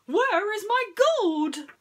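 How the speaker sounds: very high, very loud, slow wail; anguished cry. Acted. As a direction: howl for the lost gold. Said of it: "he cried".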